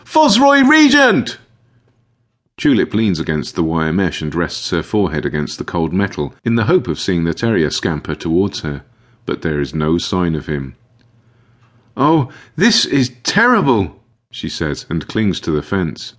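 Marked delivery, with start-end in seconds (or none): 0.0-1.4 very high, loud, long-drawn call; stretched-out high holler for a missing dog; shouting. A narrator's reading. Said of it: "shouts again". 12.0-14.0 high, slow lament; dismayed high-pitched cry, drawn out; distressed. A narrator's reading. Distressed owner finds dog missing.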